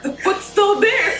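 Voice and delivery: laughing punchline. Real, not acted. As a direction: land the punchline while cracking up. Punchline of her hernia story.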